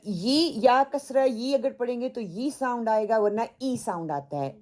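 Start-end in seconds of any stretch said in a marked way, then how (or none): none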